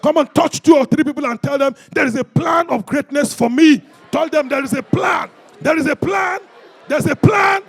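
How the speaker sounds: high, loud rallying shout; booming revival preacher's call; full-force preaching. Real, not acted. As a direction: whip the congregation up to their feet.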